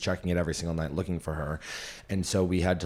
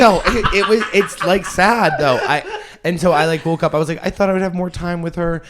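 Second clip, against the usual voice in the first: high, loud, tearful voice breaking into laughs; real crying; 10 semitones higher, 11 dB louder than usual. Real, not acted. Recalling the morning she gave up her dog, crying openly. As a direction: push through the words while crying and half laughing. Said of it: "She's for real crying".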